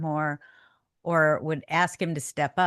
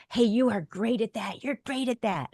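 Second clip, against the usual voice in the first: higher, cheering praise; lightly voiced cheering coach; encouraging coach; 5 semitones higher than usual. Coach praising a player, encouraging. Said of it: "or someone's like".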